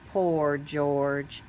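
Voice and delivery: plain reading voice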